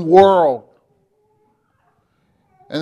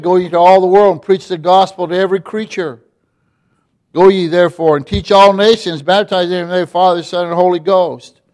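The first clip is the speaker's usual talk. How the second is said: quick, louder rolling recitation of verses; fast scripture recitation from the pulpit; preached scripture; 2 semitones higher, 4 dB louder, faster than usual. Acted. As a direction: rattle off the verses like a preacher on fire. Recites Great Commission scripture fast.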